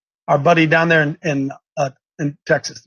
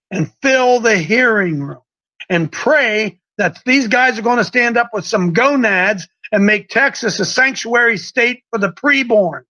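slow, higher-pitched, measured proclamation; emphatic rallying oratory; 4 semitones higher, slower than usual. Real, not acted. Host announces abortion bill hearing to listeners, preaching emphatically. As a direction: drive each phrase home to rally the faithful.

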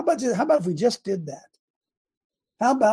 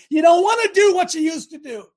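shouted high and loud, full force; fiery preacher's cry; preaching shout; 9 semitones higher, 6 dB louder than usual. Real, not acted. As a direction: let it ring out to the back pews. Preacher builds to a climax.

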